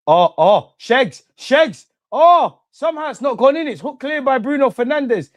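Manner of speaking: high, loud, urgent cries as the shot nearly goes in; excited near-goal yell. Real, not acted. Fan watching the match sees a shot nearly go in, excited high yell. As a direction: yell it like the ball is about to hit the net.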